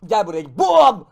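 loud shout into a high Bum; battle shout. Real, not acted. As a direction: shout as you strike. Attacking enemies in game.

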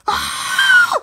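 piercing high shriek; death scream. Acted. Friend attacked by the doctor, death shriek. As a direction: shriek at full force.

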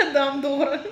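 laughing mimicry of a New York accent. Repeats the dad's accented phrase, laughing.

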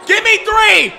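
high, very loud cheer; excited shout. Real, not acted. Viewer willing Nelson's three in, excited shout. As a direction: holler it, then a long wordless yell.

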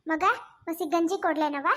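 usual speaking voice

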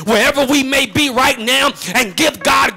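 thankful preaching, building to a shout; fervent, rhythmic sermon cadence. Real, not acted. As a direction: drive each repeated phrase harder toward a praise break. Preaching builds to full force.

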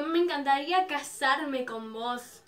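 drawn-out retold marriage wish; slow, emphatic confession to an idol; slow proposal. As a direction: stretch out a lovestruck proposal slowly. Fan proposes to idol, then repeats.

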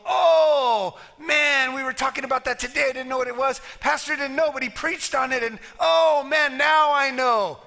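hearing it preached, a churchgoer exclaims excitedly; excited realisation. Pretend. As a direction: act out a churchgoer's excited discovery. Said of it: "you go".

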